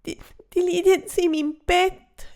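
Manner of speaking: fairy complaining the cockroach took her bed; wailing. Acted. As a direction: wail it like a heartbroken little fairy. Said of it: "jammert sie".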